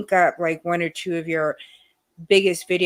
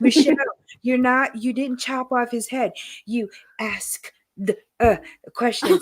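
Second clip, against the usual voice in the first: raised, correcting voice, slowing at the end; mean doctor's wife; 4 semitones higher than usual. Retold or imitated. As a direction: imitate a boss's wife scolding an employee. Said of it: "His wife was really mean".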